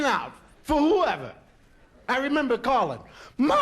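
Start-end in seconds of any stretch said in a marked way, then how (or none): 3.4-3.6 high, drawn-out panicked call; fleeing kid wailing for Ma; desperate cry for Ma. Retold or imitated. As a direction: scream for your mother as you run. Calling out while fleeing.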